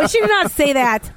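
high-pitched, scandalized protest; scandalized disgust. Real, not acted. Co-host objecting to a dirty movie quote, grossed out. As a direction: recoil at a crude joke. Recoiling from a crude joke.

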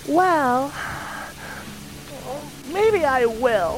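put-on a young girl turning down a stranger, prim and high; wary girl. Girl refusing the stranger's ride, high and prim. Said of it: "so here are the lyrics".

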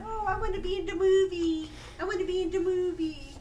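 high, loud, crying plea; hammed-up sobbing whine; whiny begging dad. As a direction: beg like a spoiled dad desperate for a part. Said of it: "his dad said".

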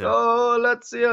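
high, slow, drawn-out cheer; high-pitched imitated match cheer; mock football fan. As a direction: stretch it out like a distant fan's chant. Repeats the football fan's shout.

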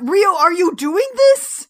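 can't believe he's doing this; exasperated disbelief. Retold or imitated. Exasperated at Rio's complaint.

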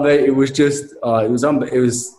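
ordinary talk, nothing marked

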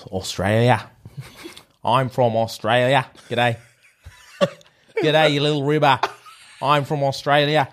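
put-on broad Aussie accent; Australian accent. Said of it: "Australia and say".

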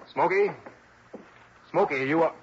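high, questioning call of a name; calling out anxiously. Acted. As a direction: call to him, hoping for an answer. Calling to unresponsive injured friend.

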